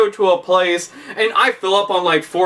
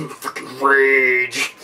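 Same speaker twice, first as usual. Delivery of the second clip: mock rage; 4 semitones lower, much slower than usual. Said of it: "they'll naturally be rage face".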